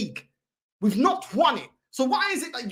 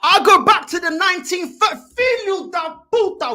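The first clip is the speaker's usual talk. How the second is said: loud, indignant burst; outraged outburst; 3 semitones higher, 7 dB louder than usual. Real, not acted. Fan throwing a rival's remark back, outraged.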